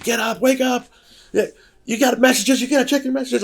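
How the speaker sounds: high-pitched urgent rousing; shrill mock wake-up call; wife waking him. Wife waking him from dead sleep, high and urgent. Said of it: "my wife calls me".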